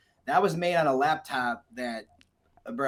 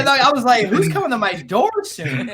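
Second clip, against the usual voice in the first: mock fear; 9 semitones higher, 9 dB louder than usual. Retold fear, much higher.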